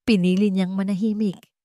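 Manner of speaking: usual speaking voice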